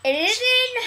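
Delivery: excited. Real, not acted. Excited announcement.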